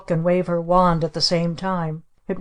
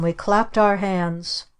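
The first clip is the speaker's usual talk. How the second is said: plain reading voice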